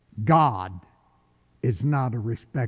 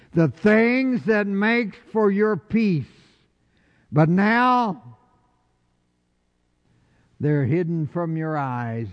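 preached scripture; 5 semitones higher than usual. Acted.

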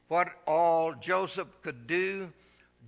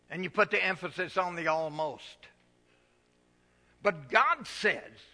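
normal talking voice

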